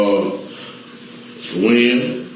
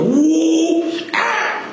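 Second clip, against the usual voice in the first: mock whoop. Car chase, cops arriving.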